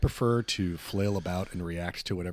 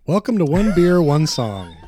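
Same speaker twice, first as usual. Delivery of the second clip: loud, higher announcer voice; announcer intro; 8 semitones higher, 13 dB louder than usual. Acted. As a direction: boom out the show title.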